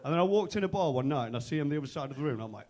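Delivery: ordinary talk, nothing marked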